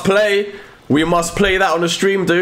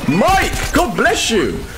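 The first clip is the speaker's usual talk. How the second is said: ordinary talk, nothing marked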